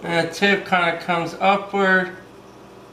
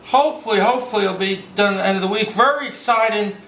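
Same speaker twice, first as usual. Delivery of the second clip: usual speaking voice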